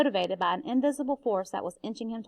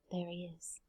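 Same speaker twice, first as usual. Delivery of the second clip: much softer, low and hushed; softly; 5 semitones lower, 16 dB quieter than usual. Acted. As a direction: say it quietly so as not to stir the dog. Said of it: "he said softly".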